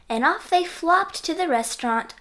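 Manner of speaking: plain reading voice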